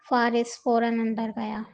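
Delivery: ordinary delivery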